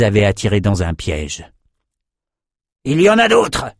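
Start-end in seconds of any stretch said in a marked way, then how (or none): 2.8-3.7 high, urgent cry; soldier's high-pitched shout; shouted warning. A narrator's reading. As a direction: alert the others to more enemies. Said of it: "cria un type armé".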